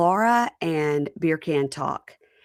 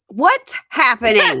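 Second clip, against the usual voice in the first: high, loud baffled cry; mock startled; baffled what; 6 semitones higher, 8 dB louder, slower than usual. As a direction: exclaim in confusion, laughing. Host finds couple in leaves.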